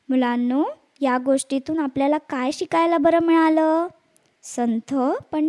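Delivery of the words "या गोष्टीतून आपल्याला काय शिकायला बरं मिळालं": usual speaking voice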